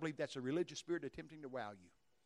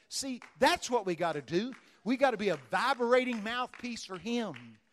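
fervent preaching; 6 semitones higher, 10 dB louder than usual. Acted. Preaching climax.

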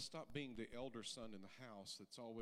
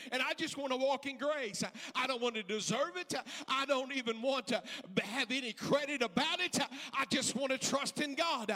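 loud, higher pleading prayer, slowing down; impassioned shouted prayer; 13 semitones higher, 16 dB louder than usual. Acted. Preacher praying aloud before the church, shouting. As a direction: cry it out to the Lord.

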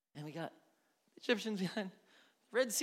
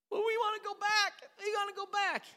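high, nasal-free whine, much higher pitch; mock whiny people; 6 dB louder than usual. Preacher mocking people begging to go back to Egypt, high whine. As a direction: whine like a spoiled kid.

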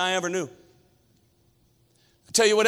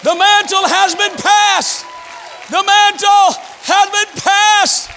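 slow, loud and higher proclamation; slow shouted preaching; 11 semitones higher, 6 dB louder, slower than usual. Acted. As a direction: proclaim it slowly with fire. Preaching at full force.

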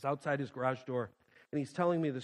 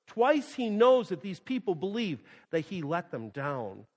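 normal talking voice

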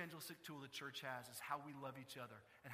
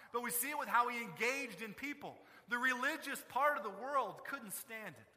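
rising sermon, much higher and louder, driving each phrase; preaching at full force; 7 semitones higher, 9 dB louder than usual. Real, not acted. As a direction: build the fire and hammer it home to the congregation.

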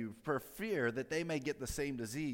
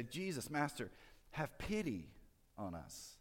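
softly voiced appeal, sinking at the end; soft plea; 7 dB quieter than usual. Lepers asking Jesus for pity, soft plea. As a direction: plead gently, letting the last words fall away.

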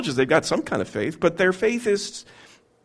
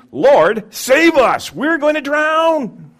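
panicked cry; 9 semitones higher, 6 dB louder, much slower than usual. Acted. Preacher acting out disciples' cry.

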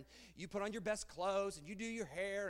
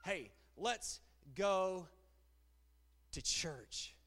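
dragged-out invitation; 4 dB louder, much slower than usual. Retold or imitated. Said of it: "They say".

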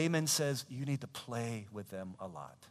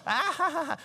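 very high mock laughter; squealed put-on laugh; imitated child laugh; 5 dB louder than usual. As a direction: cackle like a naughty little kid. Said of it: "She's laughing".

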